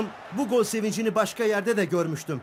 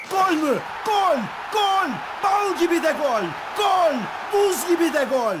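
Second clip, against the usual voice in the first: screaming football commentator; 7 semitones higher, slower than usual. Acted. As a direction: scream the goal out. Goal call.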